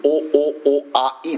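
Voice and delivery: お、お、お、あ、い as separate vowels; vowels only. Shown as a demonstration. Preacher matching the rhyme's vowels for listeners, vowel by vowel. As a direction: count out the vowels お、お、お、あ、い one by one. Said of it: "同じく".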